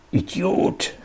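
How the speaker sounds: hissed under the breath, no voice. A narrator's reading. Heinz hisses at his buddy Alfred, whispered. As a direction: spit it out in a venomous hiss. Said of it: "zischte Heinz".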